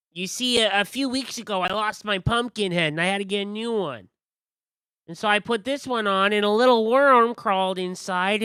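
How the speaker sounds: high, loud chatty storyteller voice; quirky high character rambling; pumpkin-head figure. Acted. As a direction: tell the odd tale proudly.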